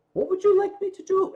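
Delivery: quick eager question from Tani; bright, hurried imitation of the driver; Tani's voice. Tani asks, voiced higher.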